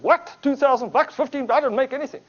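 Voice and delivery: loud, high-pitched imitation of an indignant member; incredulous outburst. Member hears speaker made two thousand bucks, mock incredulous outburst. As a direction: mimic stunned disbelief, voice shooting up.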